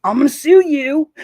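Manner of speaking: loud, high imitated outburst; hammed-up legal threat; mock threat. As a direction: mockingly yell it like a feuding creator. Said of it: "instead of this whole".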